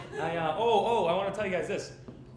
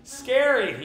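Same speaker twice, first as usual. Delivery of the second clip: louder, higher mock fear; fake scared; 3 semitones higher, 8 dB louder, much slower than usual. Jogger teasing a tiny Doberman, fake scared. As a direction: tease the little dog with a fake fright. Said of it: "like, a fake".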